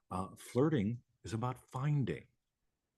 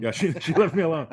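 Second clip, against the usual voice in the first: loud, higher, gleeful repeat; excited echo; 8 semitones higher, 13 dB louder than usual. Real, not acted. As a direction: throw her words back loudly, delighted. Teasing echo of partner's remark.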